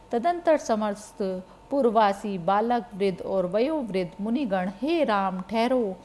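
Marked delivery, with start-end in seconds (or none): 4.8-6.0 understated calling out, louder at the end; mild narrated cry. A narrator's reading. Townsfolk calling after Ram's departing chariot, mild narrated shout. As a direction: call after someone, building to a restrained shout. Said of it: "इस प्रकार चिल्लाते हुए".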